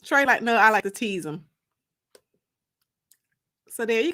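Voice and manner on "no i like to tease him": ordinary delivery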